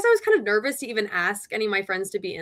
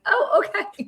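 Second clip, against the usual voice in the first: laughing surprise; 11 semitones higher, slower than usual. Real, not acted. Reacting to admin praise.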